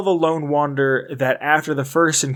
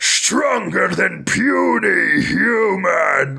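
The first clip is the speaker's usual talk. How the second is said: slow shouted brute voice, pitched up; super mutant voice; 5 semitones higher, much slower than usual. Retold or imitated. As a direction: bellow it slowly like a dim-witted giant. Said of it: "voice I do is not Wes Johnson's super mutant".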